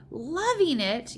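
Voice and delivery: high, loud, slow outraged yell; indignant yell. A narrator's reading. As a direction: throw the words back in disbelief. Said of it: "yelled the blood bottler".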